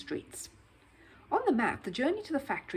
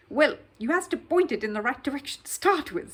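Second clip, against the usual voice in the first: louder, higher chummy cabbie; 6 semitones higher, 8 dB louder than usual. Acted. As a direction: talk like a friendly cab driver. Cab driver advising Paddington.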